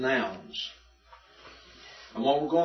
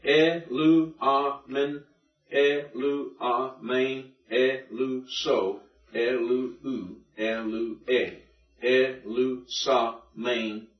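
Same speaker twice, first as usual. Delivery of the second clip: slow, evenly spaced syllables; measured syllable-by-syllable drill; much slower than usual. Shown as a demonstration. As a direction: sound out each syllable separately. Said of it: "practice seeing the syllables".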